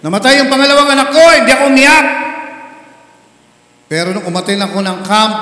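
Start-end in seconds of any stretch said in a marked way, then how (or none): 0.0-2.1 higher, louder, fast boast; mock the father's stubborn pride; proud testimony. Father boasting to the church: loud, quick, proud.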